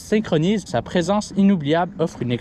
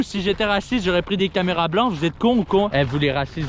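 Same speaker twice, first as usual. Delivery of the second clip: ordinary talk, nothing marked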